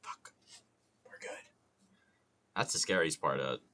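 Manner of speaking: ordinary talk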